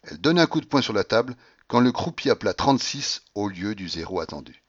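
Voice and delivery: ordinary delivery, nothing marked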